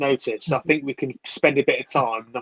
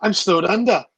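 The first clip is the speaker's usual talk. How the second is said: attempted Welsh accent, pitched higher; Bryn from Gavin and Stacey; 6 semitones higher, 4 dB louder than usual. Echoing his mate's remark in an attempted Welsh accent. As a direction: have a go at a lilting Welsh accent. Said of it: "an attempt at Bryn from Gavin and Stacey".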